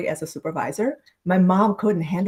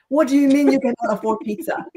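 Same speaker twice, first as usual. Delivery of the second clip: higher, louder mock scold; aunt scolding the dad over pizza, higher and louder; retold scolding; 6 semitones higher, 4 dB louder than usual. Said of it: "scolded him".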